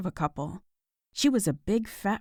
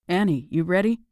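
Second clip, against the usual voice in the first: louder, called through a closed door; calling through a door; 5 dB louder than usual. Acted. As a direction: call out from the hallway. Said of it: "he called through the door".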